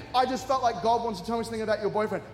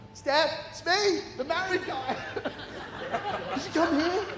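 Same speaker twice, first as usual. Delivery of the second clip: awkward laughing; 8 semitones higher than usual. Retold or imitated. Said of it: "I felt so awkward".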